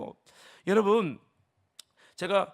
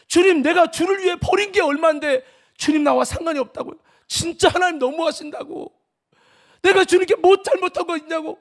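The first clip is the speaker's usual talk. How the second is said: hurt protest; 8 semitones higher, 8 dB louder than usual. Retold or imitated. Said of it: "통곡하면서 진짜 너무 서운하다고".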